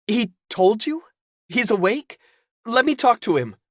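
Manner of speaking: put-on high, loud urgent young man; alarmed Caden. Caden panicking, pressing Zelda on the phone. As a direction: demand the answer.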